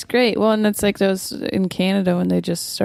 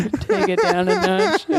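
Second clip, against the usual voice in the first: mock scolding; 12 semitones higher, slower than usual. Teasing cohost to calm down.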